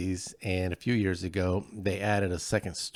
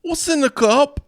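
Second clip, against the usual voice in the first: loud, much higher stage Scots-Irish brogue; cartoon Scottish-Irish accent, pitched up and loud; hammed-up Scottish-Irish accent; 9 dB louder, slower than usual. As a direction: play a gruff Scotch-Irish lout, loud and high. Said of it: "I always wanna be good at accents".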